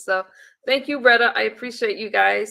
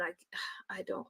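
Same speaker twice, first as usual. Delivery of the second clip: whispered; 3 semitones lower, 18 dB quieter, faster than usual. Real, not acted. Emotional about sobriety milestone.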